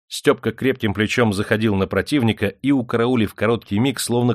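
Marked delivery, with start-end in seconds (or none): none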